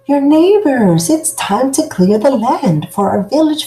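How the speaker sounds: loud, high call through a door. Acted. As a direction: call out to the house. Villagers calling at the door.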